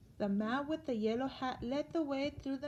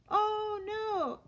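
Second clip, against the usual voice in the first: alarmed; 8 semitones higher, 4 dB louder than usual. A narrator's reading. Rock slide alarm.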